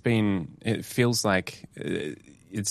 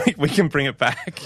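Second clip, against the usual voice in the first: laughing; 10 semitones higher than usual. Real, not acted. Laughing after joke.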